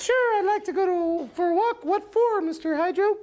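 very high falsetto puppet voice; Mister Goop. Acted. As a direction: chirp it way up high like a cartoon sidekick. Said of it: "getting into it".

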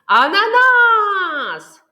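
loud, high call, stretched out. Shown as a demonstration. Teacher shows children the word said aloud, loud and drawn out. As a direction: call it out slowly at full volume. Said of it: "А тепер голосно, голосно".

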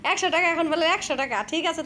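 loud laughing mockery of his new price; laughing tease. Real, not acted. Wife laughs at husband lowering his price, teasing loudly. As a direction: tease him while laughing.

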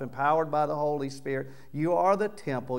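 ordinary talk

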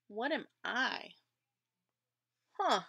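ordinary delivery

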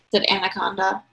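ordinary delivery

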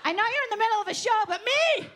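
hammed-up yell from a drunk fan; drunk friend yelling. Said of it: "one friend that's like".

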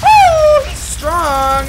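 excited whoop. Real, not acted. Surprised by strong enemy.

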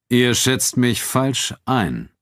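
slow, icy calm; cool him down slowly, with a chill; a slow, cold warning. A narrator's reading. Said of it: "sagte Schulz ruhig, aber mit einer Kälte".

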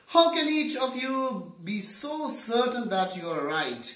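high, loud royal questioning; challenging demand. A narrator's reading. Woken king questioning the arguing blind men, challenging demand.

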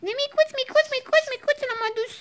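very high, repetitive cooing. Real, not acted. Doting on the little puppy, high baby talk. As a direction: gush over a tiny puppy in a high voice.

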